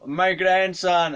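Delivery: high, loud, stretched-out greeting; effusive old man. Retold or imitated. Old man hailing his supposed grandson, effusive. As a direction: call out slowly in a hammed-up old man's voice.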